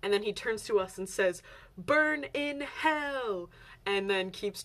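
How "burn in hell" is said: slow, drawn-out, higher mock curse; street preacher's damnation. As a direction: imitate a street preacher, stretching each word. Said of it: "chanting in Korean on a microphone".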